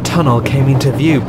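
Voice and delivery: usual speaking voice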